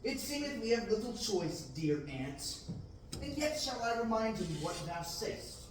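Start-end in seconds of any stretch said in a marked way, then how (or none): none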